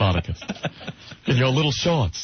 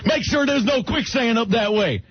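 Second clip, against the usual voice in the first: put-on Western accent shout; 7 semitones higher than usual. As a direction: holler it in a Southern twang. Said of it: "Him with that accent".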